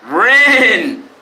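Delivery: a loud call across the room; calling out to Rin from the desk, loudly. Real, not acted. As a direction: shout the name to get her attention. Calling out to someone offscreen.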